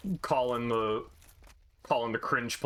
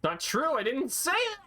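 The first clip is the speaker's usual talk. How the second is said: indignant protest; 13 semitones higher than usual. Real, not acted. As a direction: squeal out the denial. Defending against a teasing accusation.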